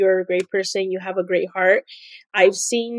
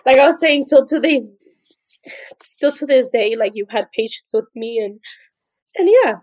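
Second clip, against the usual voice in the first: louder and higher, straining through tears; tearful; 3 semitones higher, 5 dB louder than usual. Real, not acted. Assistant thanking her boss through tears, straining. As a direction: push the thanks out past crying. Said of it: "I don't wanna cry no more".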